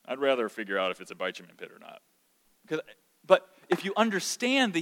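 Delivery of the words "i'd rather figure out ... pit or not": a hint of a confused reader, soft and quick; understated imitation of a puzzled Bible reader; lightly mock puzzled reader. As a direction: mutter it like a baffled reader, fast. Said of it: "And it was".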